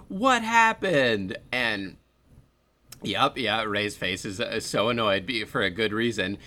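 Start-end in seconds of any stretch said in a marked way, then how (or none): 0.1-1.4 higher, slow and ditzy; mock clueless. Emily asking her boyfriend, high and slow, clueless. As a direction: ask it airheaded and slow. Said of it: "And then turns and goes".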